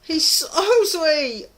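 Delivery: high, pleading scold of herself, slowing on the last words; mock inner voice. Vlogger scolding herself over the kiss, high pleading mock voice. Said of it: "It's like".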